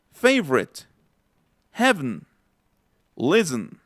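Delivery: 'Favorite, heaven, listen' as FAV-rit, HEV-n, LIS-n; each word apart, unstressed vowels swallowed in 'Favorite, heaven, listen'; reduced vowels. Shown as a demonstration. Teacher repeating for listeners, 'Favorite, heaven, listen' with weak vowels dropped. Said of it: "Escuchen, nuevamente".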